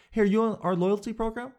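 bright sales pitch from the counter; upbeat clerk pitch. Retold or imitated. Clerk offering loyalty program, chirpy and high. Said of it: "how I think most people do it".

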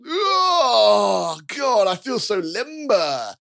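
high, slow and stretched out, a waking groan; yawning inmate luxuriating in a stretch; groggy inmate stretching. Acted. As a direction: savour the stretch like a sore prisoner. GM voices waking inmate NPC.

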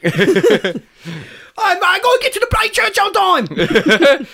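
high, broad comic British voice; hammed-up British lad straining upward. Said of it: "that's what [name] sounds like".

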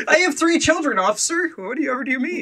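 very high, laughing protest; mock indignation. Host pretending to protest to the officer, high squeaky mock outrage. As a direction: squeak it out as a hammed-up boyish man.

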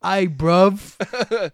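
loud, high London greeting; hammed-up English street slang; mock London accent. Co-host topping the accent joke, loud mock London.

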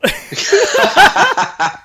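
loud high laugh; helpless giggling burst; burst of laughter. Real, not acted. Laughing at voice crack.

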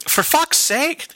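high-pitched, exasperated Irish character; Irish accent. Acted. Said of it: "into an Irish character".

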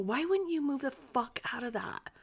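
hushed incredulous whisper. Real, not acted. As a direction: whisper it in disbelief.

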